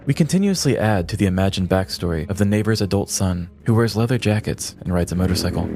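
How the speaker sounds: ordinary talk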